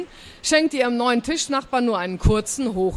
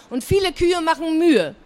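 high, louder cheeky punchline; called out; 7 semitones higher, 4 dB louder than usual. A narrator's reading. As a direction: finish the rhyme gleefully. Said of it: "rief Frieda".

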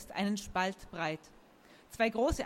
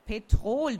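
indignant repeat; 7 dB louder than usual. A narrator's reading. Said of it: "wiederholte der Kerl".

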